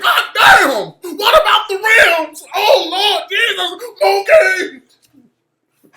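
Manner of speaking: hammed-up, high-pitched hollering; mock dramatic yelling. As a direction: wail in exaggerated alarm, very loud. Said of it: "all dramatic".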